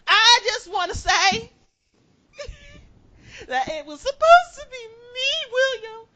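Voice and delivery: high, hammed-up crying wail; mock sobbing wail. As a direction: wail it like a jilted lover. Mock wedding objection cry.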